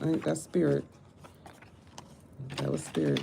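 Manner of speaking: ordinary talk, nothing marked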